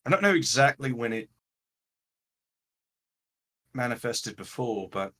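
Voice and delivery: lightly voiced game character, pausing between phrases; a hint of an in-character voice, thinking it through; Raylan, measured and reassuring. Raylan answers Evangeline about what is happening to her, lightly voiced and measured.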